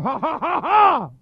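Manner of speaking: high ha's climbing to a loud last one; imitated pulpit ha, punched and rising; imitated preaching "ha". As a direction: mimic a preacher's building ha-ha outburst. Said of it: "He just started going".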